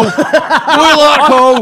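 giggling through the dare, slow and high; laughing. Real, not acted. Mocking suggestion, laughing.

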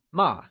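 "ma" short, pitch dropping quickly; falling tone. Shown as a demonstration. Teacher translates scold, "ma" in falling tone. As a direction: let "ma" drop sharply from the top. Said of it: "carries the falling tone".

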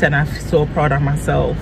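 ordinary delivery

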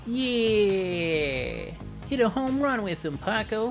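loud excited cheer. Real, not acted. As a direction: yell it like a home run. Cheering a hit in game.

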